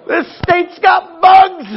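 laughing. Real, not acted. Punchline, laughing.

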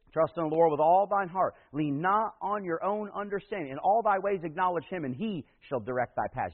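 loud, ringing scripture reading; preacher reciting Proverbs aloud; preaching scripture. Acted. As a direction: proclaim the verse to the congregation. Scripture recited at full force.

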